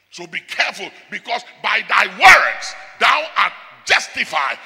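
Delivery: loud, ringing pulpit warning; full-voiced sermon delivery; preaching at full force. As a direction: thunder it at the congregation like a preacher. Preaching hard against loose talk.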